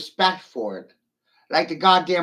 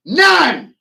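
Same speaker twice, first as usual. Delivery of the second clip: high, loud furious shout; 12 dB louder than usual. Real, not acted. Ranting at silent critics, furious shout. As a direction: bark it out in full anger.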